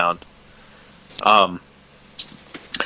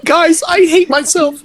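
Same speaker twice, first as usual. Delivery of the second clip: high, slow, whiny mockery of a friend; mock whiny echo. Friend mocking the self-esteem joke in a high slow whiny voice. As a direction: pitch it way up and drag it out, teasing.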